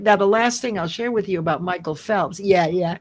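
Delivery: ordinary talk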